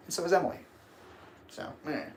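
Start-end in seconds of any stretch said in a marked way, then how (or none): none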